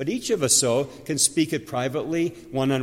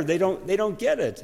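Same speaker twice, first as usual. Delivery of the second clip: usual speaking voice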